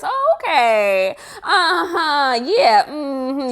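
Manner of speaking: slow oh okay, high closing uh-huh; winding-down inflection. Shown as a demonstration. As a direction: let the tone end the chat. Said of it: "with the inflection of your voice".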